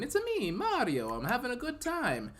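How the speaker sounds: high, bouncy cartoon Italian accent; hammed-up Italian accent, pitched high; Mario-style Italian accent. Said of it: "I give him an Italian accent".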